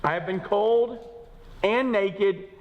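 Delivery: slow, high, drawn-out reading; Paul's sufferings read slowly; slow scripture reading. Acted. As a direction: dwell on each hardship.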